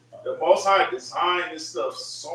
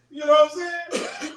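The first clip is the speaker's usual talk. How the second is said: usual speaking voice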